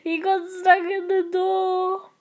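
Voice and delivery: high, slow words through laughter; laughing at a player stuck in the door; laughing hard. Real, not acted. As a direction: squeeze it out while cracking up. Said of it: "I'm crying".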